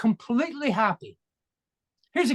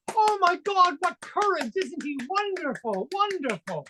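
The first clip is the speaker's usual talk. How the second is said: high, gushing admiration; mock-adoring crowd, high and breathless; gushing audience; 9 semitones higher than usual. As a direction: gush over the brave speaker. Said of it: "the whole audience goes".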